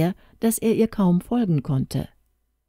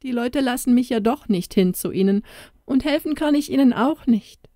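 sobbing; 8 semitones higher than usual. Acted. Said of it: "schluchzte die Kleine".

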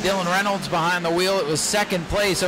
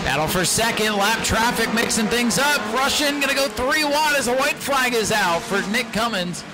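rising excitement calling the last laps; motorsport commentator; 4 semitones higher than usual. Real, not acted. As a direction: call the battle for second, building up. Race finish play-by-play.

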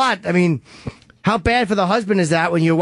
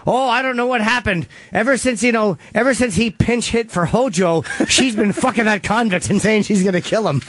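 higher-pitched gossip voice; gossiping townsperson; 3 semitones higher than usual. Acted. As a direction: play a chattering town gossip spilling the story. Gossiping townsperson acted in skit.

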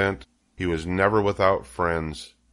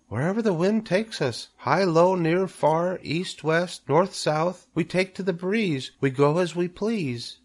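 high, small creature voice; a tiny spider announcing, pitched well up; little spider; 10 semitones higher than usual. A narrator's reading. Spiderling tells Wilbur of setting forth, high little voice.

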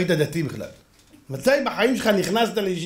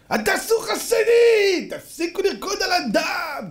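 high, slow, stretched mock screech; shouting, imitated; 12 semitones higher, slower than usual. Angry father rejecting favors, imitated high shout. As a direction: draw each accusation out in a high yell. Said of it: "אם אתה צועק".

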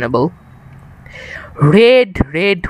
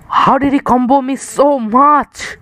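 exasperated disbelief; 7 semitones higher than usual. Real, not acted. Getting comboed by enemy.

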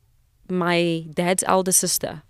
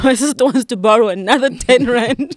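louder and higher, laughing through the story; laughing retell; 6 semitones higher, 8 dB louder than usual. Real, not acted. Telling the podcast host a funny memory of her late aunt, laughing. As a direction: giggle as you recall the aunt's joke.

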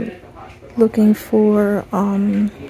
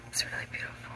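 very soft real whisper; barely audible, all breath; 19 dB quieter, much faster than usual. Real, not acted. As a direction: whisper it to yourself while browsing. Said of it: "I'm just whispering the whole time".

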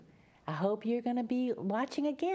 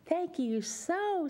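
usual speaking voice

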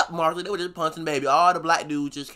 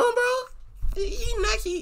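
laughing squeal; 3 dB quieter, much slower than usual. Real, not acted. Laughing commentary.

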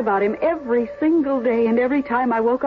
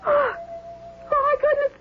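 much higher, shrill cry of fright; frightened cry; 13 semitones higher, slower than usual. Acted. Widow sees something dart through her room, crying out in fright. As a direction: cry out in sudden terror.

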